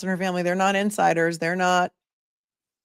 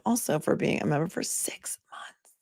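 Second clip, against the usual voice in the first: whispered; 8 dB quieter than usual. Real, not acted. Thanking member, drops voice.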